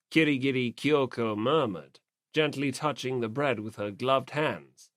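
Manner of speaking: ordinary delivery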